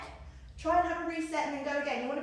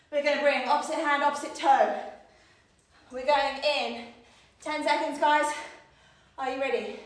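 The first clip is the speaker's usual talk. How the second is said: ordinary talk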